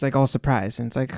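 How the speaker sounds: ordinary talk, nothing marked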